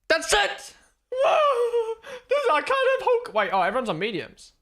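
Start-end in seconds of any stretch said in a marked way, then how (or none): 0.1-3.3 much higher, louder whoops with a laugh; excited shout. Real, not acted. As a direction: erupt in disbelief at the timing screen. Excited reaction to live timing.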